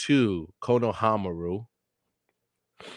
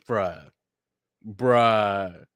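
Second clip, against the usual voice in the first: ordinary talk, nothing marked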